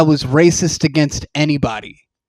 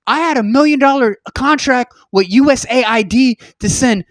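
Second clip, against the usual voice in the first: upset farmer; 6 semitones higher than usual. Retold or imitated. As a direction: rant as a desperate farmer. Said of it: "They're like".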